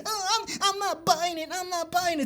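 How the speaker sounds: high squeaky whining troll. Retold or imitated. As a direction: whine it like a mocking troll. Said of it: "I make the little troll voice".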